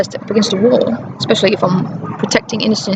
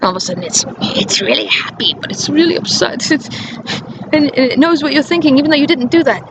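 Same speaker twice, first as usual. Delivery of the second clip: normal talking voice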